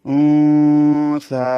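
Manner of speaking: উন সা chanted slowly and loud, nun in the nose; slow tajweed chant; ikhfa nasal hold. Shown as a demonstration. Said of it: "সেই জন্য নুনটা গুণনা করতে হবে".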